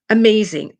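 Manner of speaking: sharp gasp, then a loud Amazing; gasped exclamation. Real, not acted. Gasps and repeats the word.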